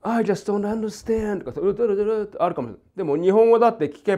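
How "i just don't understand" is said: slow, drawn-out English line, pitched up; a playful imitation of an English speaker; mock English phrase. Shown as a demonstration. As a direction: act out English rhythm slowly. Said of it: "英語ももちろん".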